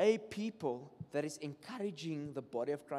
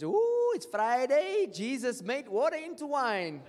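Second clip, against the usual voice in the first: much higher, loud squeal, slow laughing line; excited party lady; 6 semitones higher, 6 dB louder, slower than usual. Retold or imitated. Preacher mimics a lady at a garage excited for Friday, high squeal. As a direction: gush like someone ready to party.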